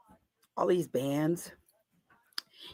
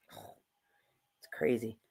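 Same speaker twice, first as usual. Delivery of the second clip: whispered aside; 11 semitones lower, 13 dB quieter, much slower than usual. Acted.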